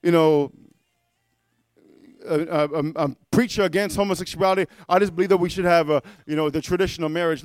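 mock hesitant waffling, low and halting; imitating a preacher dodging a direct answer; Rick Warren. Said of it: "We got Rick Warren".

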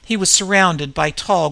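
ordinary delivery, nothing marked

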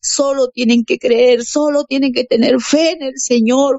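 algo más aguda, predicando con convicción; predicación. Retold or imitated. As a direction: exhorta a la gente a creer. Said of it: "Yo le decía". (predicadora animando a la gente a tener fe)

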